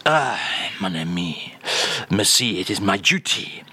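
low, suave put-on French accent. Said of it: "[name] is here to give us".

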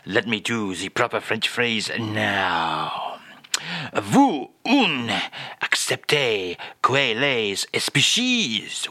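slow, hushed mock-French recital. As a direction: recite the phrase slowly with a French accent. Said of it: "And again, please, [name]".

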